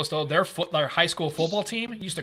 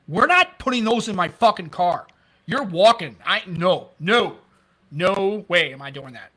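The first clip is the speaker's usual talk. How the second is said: retold indignant refusal; 4 dB louder, slower than usual. Refusing donuts in patrol car.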